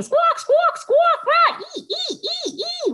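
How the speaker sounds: very high loud squawks and monkey shrieks; animal noises. Pretend. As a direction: go wild with jungle calls.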